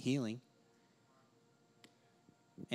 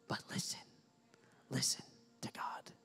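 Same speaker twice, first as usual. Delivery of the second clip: soft urgent whisper; whispered plea; 8 dB quieter than usual. Acted. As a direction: plead softly, almost breathless. Preacher's closing whispered plea.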